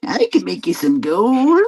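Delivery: high, strange imp voice; fairy tale imp voice. Pretend. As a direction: coax like a sly little goblin. Fairy-tale imp offering gold.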